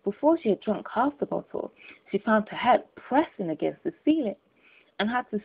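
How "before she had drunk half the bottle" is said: plain reading voice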